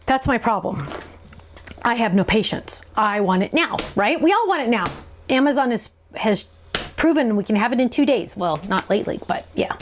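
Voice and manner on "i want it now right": ordinary delivery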